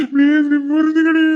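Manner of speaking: put-on crying, building to a wail; tearful pleading. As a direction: beg as if about to cry. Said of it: "நீ அழுத scene போடுறியா".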